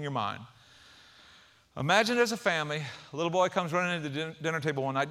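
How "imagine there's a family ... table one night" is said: normal talking voice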